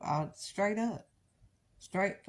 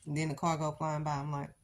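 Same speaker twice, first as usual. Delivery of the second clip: ordinary talk, nothing marked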